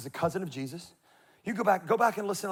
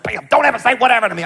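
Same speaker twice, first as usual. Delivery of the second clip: higher, louder, fast scolding mom; mock angry mom; 4 semitones higher, 9 dB louder, faster than usual. A mother scolding her teen for sassing, mock angry and fast. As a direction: snap at the kid like a fed-up mother. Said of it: "She's like".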